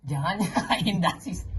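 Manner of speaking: talking through a laugh. Real, not acted. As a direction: giggle through the words. Laughs while scolding.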